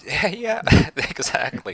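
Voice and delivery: high, loud laugh through the words; laughing agreement, can't hold it in. Real, not acted. Laughs at "online nerds" remark.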